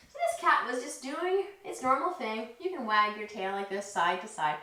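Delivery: ordinary delivery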